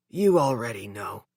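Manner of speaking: a hint of a weary son; son's voice. A narrator's reading. Hurt son answering his mother, low. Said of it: "he said".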